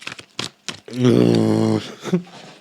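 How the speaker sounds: long, loud, rising sigh; a ship's horn of a sigh; foghorn sigh. Real, not acted. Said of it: "I sounded like a ship".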